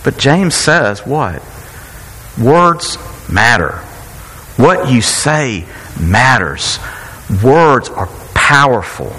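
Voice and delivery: slow, weighty sermon emphasis; emphatic preaching. Acted. Pastor driving home the point to the congregation, slow and weighty. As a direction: lean on every word, slowly.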